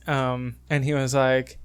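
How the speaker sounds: ordinary talk, nothing marked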